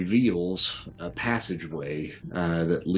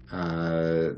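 ordinary talk, nothing marked

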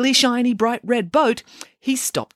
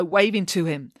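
ordinary delivery

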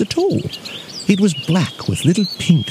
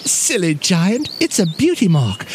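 little bear feigning astonishment; acting surprised; 6 semitones higher than usual. A narrator's reading. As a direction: start high in mock surprise. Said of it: "Brown Nose Bear acted surprised".

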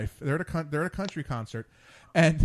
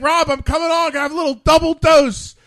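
put-on Southern accent, boisterous and pitched up; 12 semitones higher, 11 dB louder than usual. Bob pitching his wine in a loud Southern accent. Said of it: "he says it in his southern accent".

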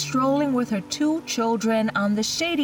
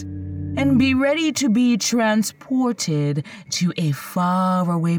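slow, ominous storyteller intro; horror host; 2 semitones lower, 3 dB louder than usual. Acted. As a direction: draw them in slow and eerie.

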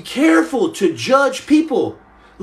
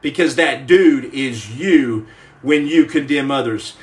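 ordinary delivery

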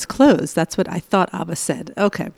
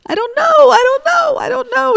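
put-on much higher, louder wailing child's voice; imitating crying son; 5 dB louder than usual. Son answering his yelling mother, mock crying. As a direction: mimic a panicked, tearful kid. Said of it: "he started crying".

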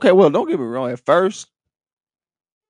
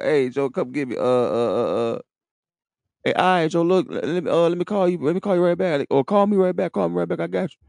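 a lightly voiced evasive buddy, a bit higher, stalling; dodging friend on phone; 7 semitones higher than usual. Imitating a friend brushing off Joe's call from jail, lightly higher and evasive. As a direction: stall him with empty promises, a touch higher.

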